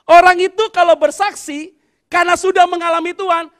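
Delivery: loud, slow scripture reading led from the pulpit; loud group reading. Acted. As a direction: lead the whole church reading scripture aloud. Leading congregation reading aloud.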